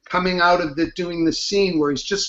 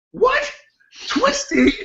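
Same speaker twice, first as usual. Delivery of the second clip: incredulous; slower than usual. Retold or imitated. Father baffled by daughter's tears.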